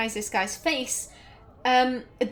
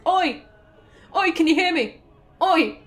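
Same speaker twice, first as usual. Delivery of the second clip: louder, higher mock call across the walls; mock shouting; 6 semitones higher, 5 dB louder than usual. Gamer imagining Tegan calling to the castle, mock shouting. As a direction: yell up at the castle, hammed-up. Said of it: "literally just stood on one side of the castle walls going".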